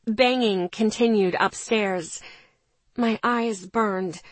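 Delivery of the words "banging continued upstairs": ordinary delivery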